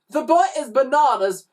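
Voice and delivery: high, loud dismissive voice; grunted scorn. A narrator's reading. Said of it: "grunted Glenda".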